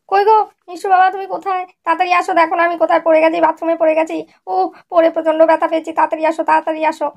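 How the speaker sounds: wife pretending she fell, calling husband to the bathroom; mock shouting for help. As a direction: call him in urgently, hamming up the pain. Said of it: "চিৎকার করছে".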